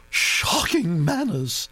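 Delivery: put-on high, brisk aristocratic old lady; old duchess voice. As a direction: gossip like an imperious old duchess. Duchess comments.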